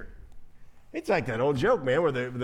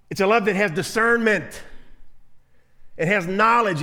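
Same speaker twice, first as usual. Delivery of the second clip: louder preaching emphasis; 3 semitones higher, 4 dB louder than usual. Acted.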